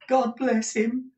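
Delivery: high, slow fervent cry; high-pitched devoted exclamation; Smike crying out. A narrator's reading. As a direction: bless him in a high, earnest voice. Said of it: "cried Smike".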